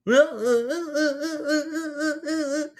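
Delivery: high burst of real laughter; real laughing fit. Real, not acted. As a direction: laugh hard at the memory. Teller laughs at the story.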